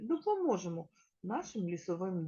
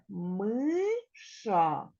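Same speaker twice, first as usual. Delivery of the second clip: slow sounding out. Shown as a demonstration. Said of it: "teacher sounds out word slowly".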